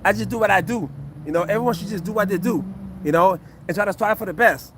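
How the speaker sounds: usual speaking voice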